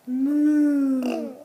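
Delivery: loud drawn-out moo; cow moo. Shown as a demonstration. Mother mooing like a cow for her baby. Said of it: "say what a cow says".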